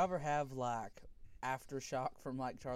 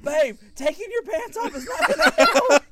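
high, loud incredulous shouting; hammed-up exasperation at full volume; exasperated yell; 17 dB louder than usual. Husband reasoning with cramping wife, exasperated yell.

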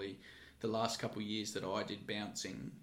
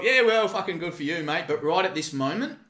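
loud, high laughing gloat; 8 semitones higher, 14 dB louder than usual. Retold or imitated. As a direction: rub it in his face, laughing. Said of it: "And I'm like".

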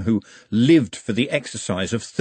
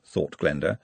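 plain reading voice

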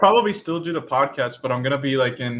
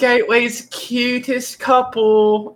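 high, slow British compliment; drawn-out, high-pitched British lady voice; British lady impression; 7 semitones higher, slower than usual. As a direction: imitate a gushing British neighbour. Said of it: "this lady goes".

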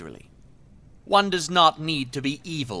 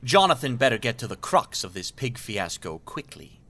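usual speaking voice

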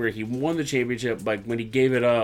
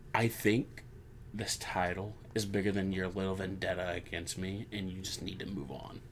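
quiet condescending Seth; 5 semitones lower, 13 dB quieter than usual. Retold or imitated. Voicing Seth brushing Finn off.

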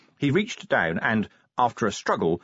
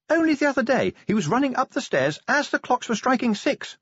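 high-pitched anxious lady; Sybil's voice; 14 semitones higher than usual. A narrator's reading. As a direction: keep Sybil up high and brisk. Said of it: "Sybil said".

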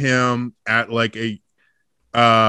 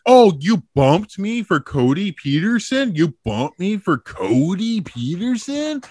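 mock indignation, higher pitch; retold yelling; 5 semitones higher than usual. Comic complaining to the host about being bumped, mock outrage. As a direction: imitate an affronted comic complaining. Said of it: "like, yelling at the host".

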